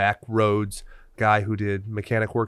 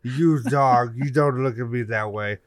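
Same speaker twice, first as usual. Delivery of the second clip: snooty poodle voice; slower than usual. Retold or imitated. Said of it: "It's a French poodle, and he's like".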